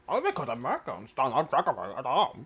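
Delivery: exaggerated mumbled British accent; mocking British imitation. Throwing the guest's jibe back, mock British accent.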